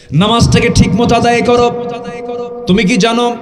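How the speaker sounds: high, loud sermon exhortation; full-force preaching. Acted. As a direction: preach it at a sustained high pitch, easing at the end.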